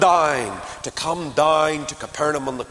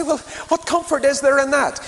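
mock objection; 11 semitones higher, 4 dB louder than usual.

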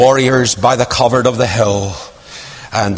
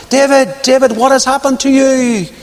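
anguished plea; 11 semitones higher than usual. Retold or imitated. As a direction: call him back to himself. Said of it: "she's saying".

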